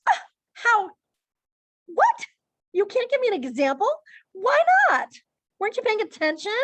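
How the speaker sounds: high, loud mock-incredulous questioning; sarcastic. Shown as a demonstration. Teacher fakes shock at a student without an example, sarcastic. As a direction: hammer each question with exaggerated sarcastic disbelief. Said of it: "That's sarcastic".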